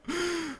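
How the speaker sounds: a high, breathy mock gasp of emotion. Streamer fakes being overwhelmed by emotion with a gasp. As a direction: gasp as if overcome, hammed up. Fake emotional moment in press conference.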